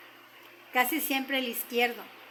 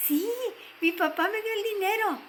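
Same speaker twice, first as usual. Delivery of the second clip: put-on high, delighted child's exclamation; excited little girl; 9 semitones higher than usual. A narrator's reading. Girl telling the shop owner about papá's money, thrilled. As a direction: burst out with joy, pitched right up.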